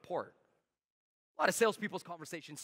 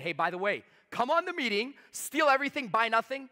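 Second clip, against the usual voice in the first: bright, higher and louder invitation; upbeat pitch line; 6 semitones higher, 4 dB louder than usual. Shown as a demonstration. Coaching sales reps, demoing an upbeat pitch. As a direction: sell it with rising energy, steal everything, buy nothing. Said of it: "I said".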